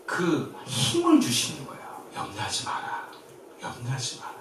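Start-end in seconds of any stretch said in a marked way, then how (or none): 2.1-4.3 soft whisper. Acted. Pastor voicing Jesus' comfort.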